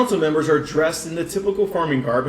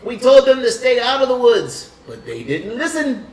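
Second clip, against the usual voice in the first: pompous council elder; 9 semitones higher, 5 dB louder than usual. Acted. Council elder lecturing the party, pompous and high. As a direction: puff up like a pompous old elder. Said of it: "another member stands up".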